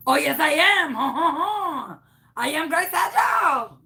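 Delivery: insistent hammed-up French-Canadian accent with a fake ha-ha-ha. Caller insisting to the clerk she's Grace, fake Canadian accent. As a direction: protest brightly in a phony French accent, rising high at the end. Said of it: "What happened to your accent?".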